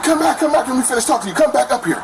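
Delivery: high, urgent call up the stairs; calling after her. Retold or imitated. Boyfriend calling his ex back up from the shower, high and urgent. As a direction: call her back before she leaves.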